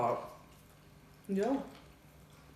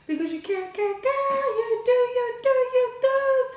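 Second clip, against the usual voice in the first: excited; 10 dB louder than usual. Real, not acted. Hyped sign-off chant.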